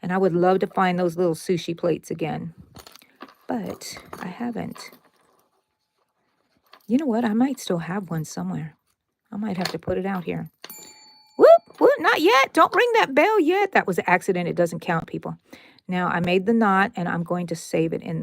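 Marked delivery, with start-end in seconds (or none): 3.5-4.9 soft wistful whisper. Real, not acted. To viewers, about lost plates, whispered. 11.4-13.7 high, loud whoop of alarm; excited alarm. Real, not acted. To viewers after an accidental stitch, high alarmed whoop.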